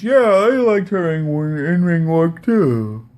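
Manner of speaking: mocking drawn-out impression; Don Tony. Host mimicking podcaster changing his tune, drawn-out mocking voice. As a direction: drag it out high, mimicking a waffler. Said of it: "And then Don Tony changed".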